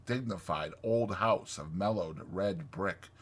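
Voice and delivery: ordinary delivery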